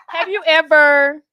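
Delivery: ordinary talk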